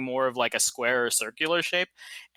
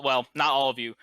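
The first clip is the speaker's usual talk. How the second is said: ordinary talk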